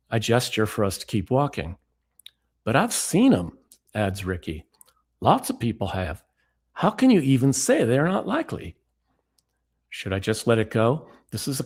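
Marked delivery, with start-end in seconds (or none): 2.6-3.6 put-on Southern accent. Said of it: "my bad impression of Ricky". 5.2-8.8 a mild impression of a Southern friend insisting; a touch of a Southern accent, pitch rising. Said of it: "my bad impression of Ricky".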